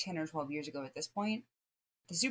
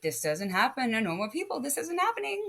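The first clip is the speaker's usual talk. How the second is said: retold panicked disbelief; 9 semitones higher than usual. Dreamer unable to wake up, retold rising panic. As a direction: pitch climbing steeply in mock panic.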